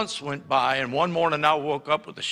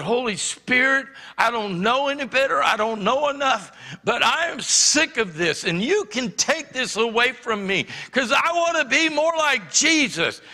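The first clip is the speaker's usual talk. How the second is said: higher, pleading, urgent prayer; fervent prayer; 7 semitones higher than usual. Retold or imitated. Teen begging the Holy Spirit, higher fervent plea. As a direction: pour it out, desperate to be free.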